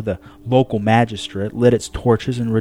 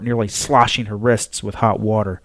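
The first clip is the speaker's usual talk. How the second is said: ordinary delivery, nothing marked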